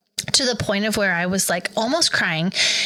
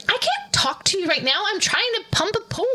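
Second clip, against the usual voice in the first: higher, hurried, harried mock panic; retold flustered rush, higher and faster; frantic brush-off; 10 semitones higher, faster than usual. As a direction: rattle it off, too busy to talk, pitch climbing. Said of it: "And I'm like".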